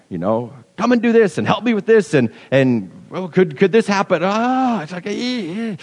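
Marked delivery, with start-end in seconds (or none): 0.8-4.2 high, pestering voice of people interrupting; the interrupters nagging, voiced higher; mock nagging interruptions. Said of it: "interjections into it". 4.2-5.8 frustrated groan. Retold or imitated. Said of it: "when I'm getting interrupted".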